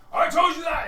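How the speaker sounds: high, very loud yell; full-force acted squabble; angry orc retort. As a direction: shout it at a rival orc. Orc still arguing.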